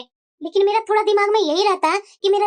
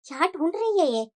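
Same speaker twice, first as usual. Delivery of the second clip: low-key, soft talk to oneself; softly wondering aloud, unheard; suspicious muttering; 4 semitones lower, 7 dB quieter than usual. Acted. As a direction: mutter suspiciously under your breath. Mother-in-law spying, talking to herself.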